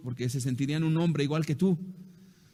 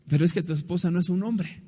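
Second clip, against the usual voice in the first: ordinary talk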